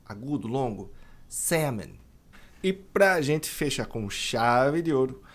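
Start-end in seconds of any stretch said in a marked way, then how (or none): 1.3-1.9 "salmon" as SAM-un, no L sound; silent L. Shown as a demonstration. Teacher shows students the silent L in "salmon". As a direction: drop the L, say "salmon" with a short a. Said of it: "esse L não é pronunciado".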